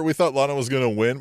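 usual speaking voice

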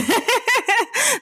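a burst of high-pitched giggling; high giggle. Real, not acted. Giggling high after joking about fighting anybody. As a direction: giggle high, amused at your own joke. Giggles at her own joke.

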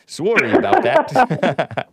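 put-on teasing, drawn-out Canadian accent with laughter; exaggerated Canadian vowels, amused; mock Canadian accent. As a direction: mimic a Canadian apology, laughing. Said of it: "in your Canadian accent".

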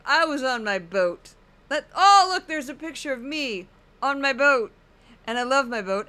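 lightly voiced showing-off host; Geraldo impression. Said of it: "I know I'm not really getting his voice".

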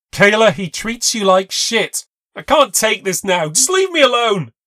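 loud, high exasperated yell; angry retort. A narrator's reading. Narrator shouting back at Kayla, loud and exasperated. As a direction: shout back, at the end of her rope.

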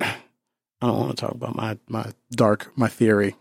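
dropping to a hush. Real, not acted. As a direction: say it almost under breath. Reluctant hushed confession.

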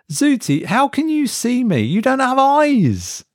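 unsettled, trying to reason it away; nervous disbelief. A narrator's reading. Mark unsettled questioning device.

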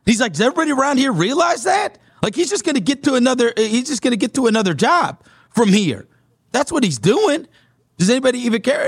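a hint of a fired-up radio host ranting; retelling the radio host. Radio host JT rants opinion.

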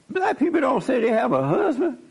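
incredulous, laughing it out; laughing disbelief. Real, not acted. Pastor reacting to the guest's husband, laughing in disbelief.

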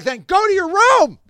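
high, sharp, sudden snap; mock-outraged high retort; exasperated retort. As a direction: fire it back at him, fed up. Snapping back at drunk man.

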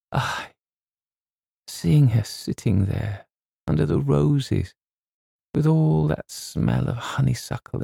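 tearful. Acted. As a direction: choke up over the poor wee lass. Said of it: "tears suddenly filled his hard-boiled eyes".

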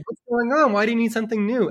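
high, loud baffled questions; mock-confused questioning of the king; bewildered boy. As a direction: ask like the world's gone mad. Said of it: "And he's like".

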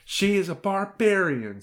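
put-on high-pitched voice of an outraged child; indignant little girl. A narrator's reading. Hilary condemns her grandmother to the wolf, indignant.

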